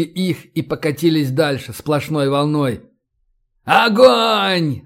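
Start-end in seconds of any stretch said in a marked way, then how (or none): 3.6-4.8 high, drawn-out battle order; yelled command. A narrator's reading. Commanders ordering the squad to fire, yelled command. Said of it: "заорали одновременно Гумилёв".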